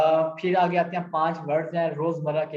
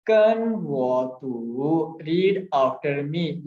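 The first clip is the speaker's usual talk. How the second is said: slow Chinese phrase; much slower than usual. Shown as a demonstration. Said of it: "यानी मेरे बाद पढ़ो".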